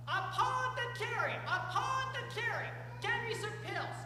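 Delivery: calling out. Acted. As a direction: call for help across the palace.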